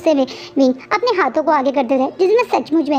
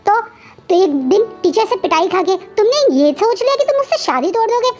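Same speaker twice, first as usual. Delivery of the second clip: high, rising angry rebuke; angry scolding; 6 semitones higher than usual. Acted. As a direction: scold him, voice climbing in anger. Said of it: "वांग जी गुस्से में wing पे बोलता है।". (ऊँची आवाज़ में गुस्से से डाँटना)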